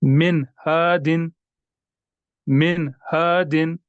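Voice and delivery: "मिन हादिन" slow, न of मिन fully sounded; careful recitation with a clean न; clear noon. Shown as a demonstration. Teacher recites example for students. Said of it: "ये देखिए".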